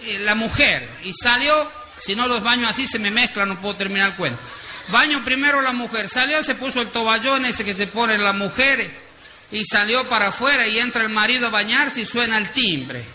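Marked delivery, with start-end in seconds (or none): none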